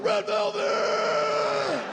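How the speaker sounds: very high, very slow shriek; gleeful shout. Pretend. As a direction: shout back in joy. Neighbour shouts back.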